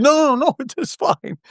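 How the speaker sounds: high, light reassurance; reassuring. Retold or imitated. Actor reassuring his co-star after her blown line, light and high.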